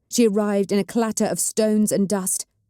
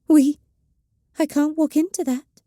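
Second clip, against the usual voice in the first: higher, fearful small voice; 9 semitones higher, 4 dB louder than usual. A narrator's reading. The witch refusing the ritual, high small frightened voice. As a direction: shrink from the ritual. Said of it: "in a small voice".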